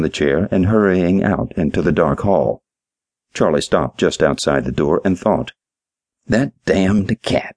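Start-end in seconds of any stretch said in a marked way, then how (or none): 6.3-7.5 slow, grumbling under his breath; annoyed mumble. A narrator's reading. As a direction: mutter it, fed up with the cat. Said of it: "he mumbled".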